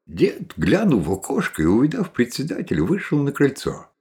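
plain reading voice

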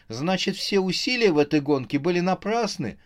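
high-pitched, understated whimper; plaintive, on the edge of tears. A narrator's reading. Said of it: "стала всхлипывать она". (жалобно, почти всхлипывая)

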